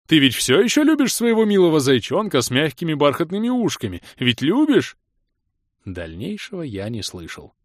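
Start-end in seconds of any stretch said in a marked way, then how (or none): 0.1-5.0 put-on high, loud cooing baby talk. A narrator's reading. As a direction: coo at her like to a toddler. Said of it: "засюсюкал Алый Крестоносец".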